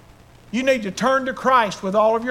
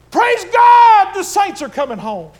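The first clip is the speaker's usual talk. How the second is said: a high triumphant cry that settles; archangel's shout; 10 semitones higher, 11 dB louder than usual. A narrator's reading. Archangel announces the saints coming home, triumphant shout. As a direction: announce heaven's news with a burst. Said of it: "the voice of the archangel".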